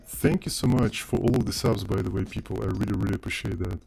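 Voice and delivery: ordinary talk, nothing marked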